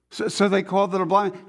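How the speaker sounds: normal talking voice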